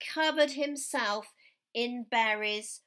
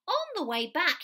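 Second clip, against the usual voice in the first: usual speaking voice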